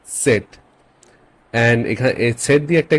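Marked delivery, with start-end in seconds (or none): 0.1-0.5 "সেট" begun with a light T before S; German Z as TS. Shown as a demonstration. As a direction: put a small T in front of "সেট". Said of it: "সামনে একটা ত এর মতো আসবে". ("সেট" শুরুতে ত-এর মতো শব্দ দিয়ে)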